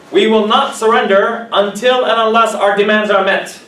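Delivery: loud defiant strike chant; protest slogan. Shown as a demonstration. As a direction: proclaim it like a striker at a rally. Protest chant example, strikers' demand.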